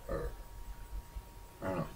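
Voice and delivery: normal talking voice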